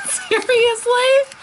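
high, slow "Seriously?" through laughter; giggling in disbelief at the product; laughing disbelief. Real, not acted. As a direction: drag it out while laughing.

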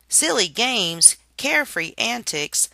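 loud, slow, sneering repeat; mocking echo. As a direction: throw her words back with scorn. Narrator mocks her words.

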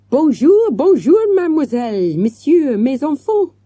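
put-on loud, high, French-accented greeting; an effusive hotel manager crying out hello; excited French cry. A narrator's reading. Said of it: "he cried".

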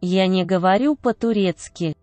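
normal talking voice

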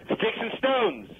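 high, drawn-out team call; game yell. Shown as a demonstration. Demonstrating the schoolyard game to a friend, high sing-out yell. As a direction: call it out slowly in a high pitch, falling at the end. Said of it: "So I'm gonna yell".

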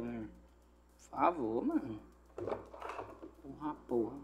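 whispered curse; cursing under breath. Real, not acted. Irritado com o goleiro. (palavrão sussurrado)

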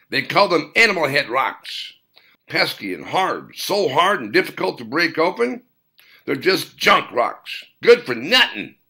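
a hint of a cranky old man grumbling about junk rocks; gruff old mountain man. Old man voiced in story.

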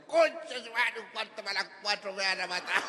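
high, put-on drunk's dismay; high start, sinking drunken lament; alarmed drunk. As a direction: blurt it out as a drunk realising he is in trouble. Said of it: "el borracho quedó mirando y dijo".

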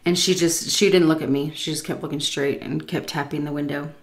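ordinary talk, nothing marked

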